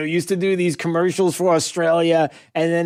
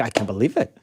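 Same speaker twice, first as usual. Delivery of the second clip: mock disbelief; 5 semitones lower than usual. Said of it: "and it's like".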